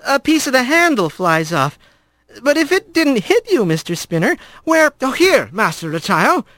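put-on a boy rat asking carefully; young rat. A narrator's reading. Young rat questioning the stern father, nervous and high.

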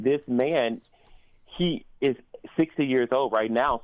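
ordinary talk

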